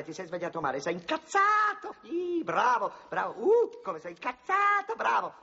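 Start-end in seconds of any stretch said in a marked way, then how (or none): 0.8-3.8 much higher, slow hammed-up cheer; playful high cry of praise; mock cheering. Photographer coaching the painting. 3.8-5.3 playful high cry of praise; mock cheering. Photographer coaching the painting.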